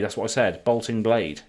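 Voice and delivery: ordinary talk, nothing marked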